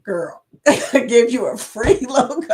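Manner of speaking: laughing mock sass as Denise; imitating Denise. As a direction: tease it like a friend scolding, half-laughing. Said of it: "she's probably thinking".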